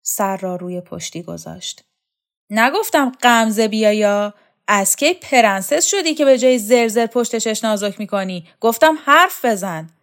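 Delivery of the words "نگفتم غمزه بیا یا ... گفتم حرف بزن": higher, louder impatient command; impatient bark. Acted. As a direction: snap at him to speak up. Shokat orders Vahab to talk.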